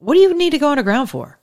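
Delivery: high, emphatic no; incredulous high retort; exasperated retort. Retold or imitated. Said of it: "I'm like".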